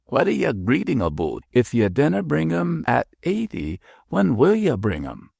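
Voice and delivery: a lightly put-on Scottish sexton; mock Scots brogue, half-hearted; bad Scotch accent. George retells the Scottish sexton's joke, bad Scotch accent. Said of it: "My Scotch accent is bad".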